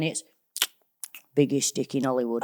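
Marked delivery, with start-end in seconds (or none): 1.3-2.4 put-on northern accent. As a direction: drop into a Chesterfield voice. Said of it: "if I ever have to go into my northern".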